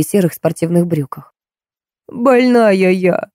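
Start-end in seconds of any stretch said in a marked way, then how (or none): 2.1-3.3 put-on whining old woman. A narrator's reading. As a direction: stretch every word into a pitiful whine. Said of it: "проныла бабка".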